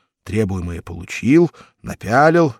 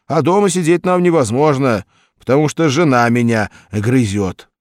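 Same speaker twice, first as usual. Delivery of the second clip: higher and louder, lightly aggrieved; complaining husband; 5 semitones higher, 9 dB louder than usual. A narrator's reading. Hikin complains to the stranger about his wife, mild grumble.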